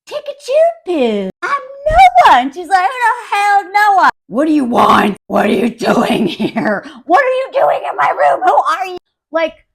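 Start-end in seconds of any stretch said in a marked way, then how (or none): 0.1-2.4 placating, climbing to a high yell; Noah. Retold or imitated. Husband telling upset wife to relax, mock soothing turning shrieky. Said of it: "is like". 2.9-9.0 loud mock-hysterical demands; hammed-up panicked yelling; aggressive. Frightened wife confronting man in her room, mock aggressive shouting. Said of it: "I love how aggressive she is".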